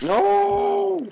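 very high, loud drawn-out no; anguished shout. Real, not acted. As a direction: scream out the failure. Fails the jump again.

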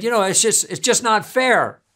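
a touch of a flustered student giving up; frustrated. Retold or imitated. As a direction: let the frustration spill out a bit. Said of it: "he got all frustrated".

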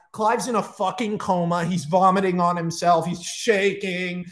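normal talking voice

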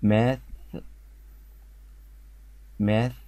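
ماث ending on th with the tongue poking out a bit; tongue-out th demo. Shown as a demonstration. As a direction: model ماث for repeating, tongue between the teeth on the final th. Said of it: "كأني بطلع لساني حتة كده".

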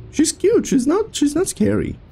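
squeaky high-pitched teasing. Real, not acted. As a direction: pitch way up, playful. High squeaky remark on smiling woman.

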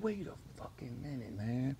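stunned. Retold or imitated. Zero spotting Al, hushed stunned disbelief. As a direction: breathe it out in shock. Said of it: "He said".

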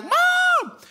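imitated toddler's very high call; long, high mock-child cry; high-pitched mommy. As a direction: yell for mom like a little kid. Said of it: "we hear the initial high-pitched mommy".

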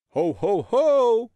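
a big jolly Santa greeting from behind; Santa's ho ho ho. Acted. Dad dressed as Santa calling behind the boy, booming jolly. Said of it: "usłyszał za plecami".

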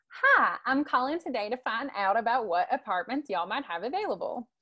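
friendly Southern accent, y'all and all. Shown as a demonstration. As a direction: call about an apartment in a light Southern accent. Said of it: "the Southern voice is something like".

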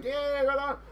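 high, bright mock cheer. Grower recalling his excitement at cheap mulch, mock cheer. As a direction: cheer it like a happy fool. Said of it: "I was like".

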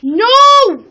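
very high, loud scream of no; desperate scream. Real, not acted. Gamer screaming at the wizard near his house, desperate. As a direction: scream it as the base is threatened.

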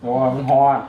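speaking through a scalding mouthful; words round hot food burning the mouth; mouth full of hot food. Real, not acted. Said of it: "ฮ้อนได้บ่ไหวลวกปาก".

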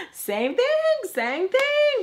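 very high squeaky taunt; exaggerated high-pitched mockery; mocking retort. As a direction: squeal it as a silly mimicry.

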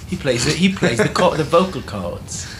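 lightly hammed British accent, joking; mock British accent. Violinist joking about the singer, mild mock British accent. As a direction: crack the joke in a mild put-on British accent. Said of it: "Everyone break accent".

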